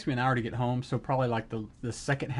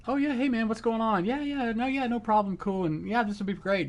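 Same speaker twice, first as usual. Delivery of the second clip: much higher, overly breezy chatter; bright fake-cool small talk; forced casual; 9 semitones higher than usual. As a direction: try too hard to sound relaxed. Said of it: "go to this place of just".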